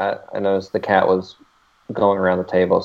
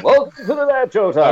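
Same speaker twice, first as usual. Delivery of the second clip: announcer voice; 12 semitones higher than usual. Acted. Announcing dad joke segment.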